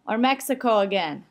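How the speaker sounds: ordinary talk, nothing marked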